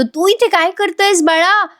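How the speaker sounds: put-on high, slow cooing to a baby; imitating an uncle's singsong baby talk. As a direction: coo playfully at the little one.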